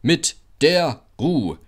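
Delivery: slow, grumbling truck voices; grumbling freight cars, slow. A narrator's reading. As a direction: drag it out like sulky wagons. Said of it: "murrten die Güterwagen".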